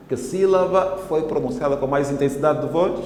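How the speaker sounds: ordinary talk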